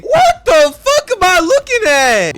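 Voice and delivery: very high, loud, drawn-out yell; incredulous shout. Real, not acted. Narrator appalled by the anime, incredulous shout. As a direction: explode in disbelief, stretching the words. Outburst at the spiral body.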